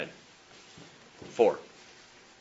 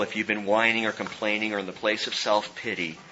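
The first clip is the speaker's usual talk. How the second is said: usual speaking voice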